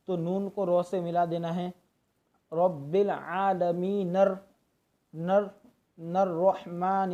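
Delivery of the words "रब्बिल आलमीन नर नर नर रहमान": slow, नून merged into रॉ; joined reading. Shown as a demonstration. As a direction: slowly blend नर into रहमान. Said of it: "इस तरह हमें मिला के पढ़ना है". (नून को रॉ से मिलाकर)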